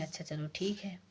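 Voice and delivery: soft unvoiced whisper of agreement; muttered okay in a whisper; whispered reply. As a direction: breathe it out under the breath. Daughter-in-law grudgingly agrees.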